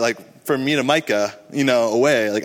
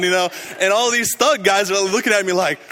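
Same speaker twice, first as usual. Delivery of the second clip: usual speaking voice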